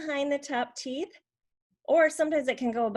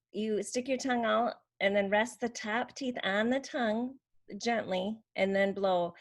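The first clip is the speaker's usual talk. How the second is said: ordinary talk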